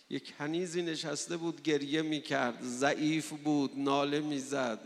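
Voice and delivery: ordinary delivery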